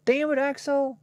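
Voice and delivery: high, loud exclamation of exasperation; exasperated scolding. Real, not acted. Streamer telling off a chatter, exasperated. Mock scolding of chat member Axel.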